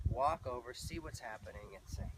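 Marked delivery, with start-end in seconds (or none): none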